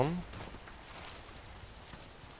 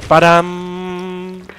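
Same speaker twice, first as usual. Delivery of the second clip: loud playful boom; explosion sound effect; 6 semitones higher, 6 dB louder than usual. Real, not acted.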